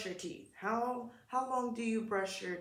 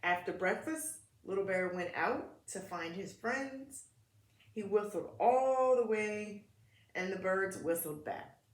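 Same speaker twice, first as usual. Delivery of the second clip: put-on gentle read-aloud for kids; children's story narration; storybook reading; 3 dB louder than usual. A narrator's reading. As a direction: read it like a picture book at story time.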